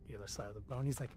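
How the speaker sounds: ordinary talk, nothing marked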